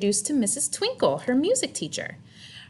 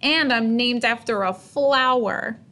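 whiny; 4 semitones higher, 4 dB louder, slower than usual. Acted. Child whining.

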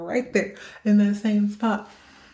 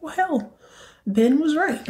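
surprised realization; 9 semitones higher than usual. Retold or imitated. Surprised at finding bug.

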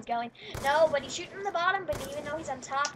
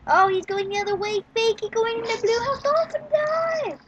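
mock-epic rising commentary; building play-by-play; 8 semitones higher, 6 dB louder than usual. Real, not acted. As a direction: pitch climbing steadily to a high peak.

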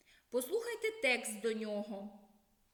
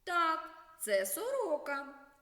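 put-on higher, slower little girl's reply; girl answering; 6 semitones higher, 3 dB louder, slower than usual. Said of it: "Наприклад". (вища, повільна відповідь дівчинки)

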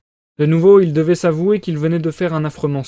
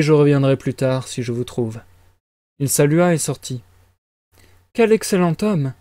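ordinary delivery

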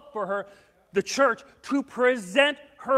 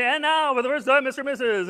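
a light, louder emcee call; mock DJ announcement; 3 semitones higher, 6 dB louder, faster than usual. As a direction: announce the couple like a wedding DJ. DJ introduces couple at dance.

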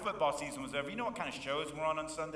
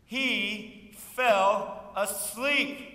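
drawn-out line; 7 semitones higher, 8 dB louder, much slower than usual. Acted. Preacher drawing out the story.

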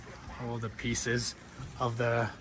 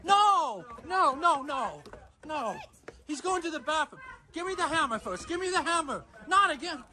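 yelling; 6 semitones higher than usual. Real, not acted. Chasing kid for hammer.

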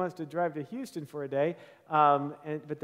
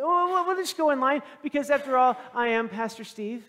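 mock self-important; 6 semitones higher, 4 dB louder than usual. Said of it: "And it's like".

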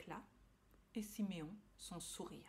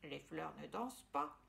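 low dismissive thought; 6 semitones lower than usual. Acted. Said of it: "pensa notre papillon".

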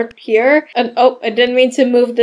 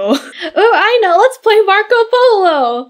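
high-pitched bright-idea voice; high, keen pretend-play suggestion; eager doll character; 7 semitones higher than usual. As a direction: pitch up like an excited toy. Doll proposes a game.

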